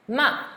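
short falling "ma"; fourth tone. Shown as a demonstration. Teacher running through four tones on one sound, "ma" falling sharply. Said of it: "Now, M A".

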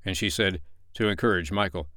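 ordinary delivery, nothing marked

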